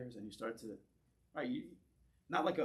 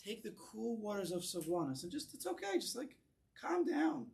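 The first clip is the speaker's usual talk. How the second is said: mock soothing; 6 semitones higher than usual. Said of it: "you're telling this guy".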